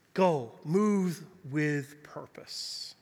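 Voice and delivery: very slow, low and soft; solemn refrain. Real, not acted. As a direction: land each word slowly and quietly. Closing refrain of the message.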